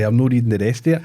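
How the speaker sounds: ordinary delivery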